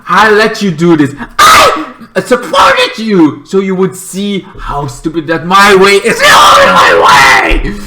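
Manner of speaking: hammed-up furious villain rant; Lex ranting. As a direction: insist hard, rising into a yell. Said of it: "Lex like".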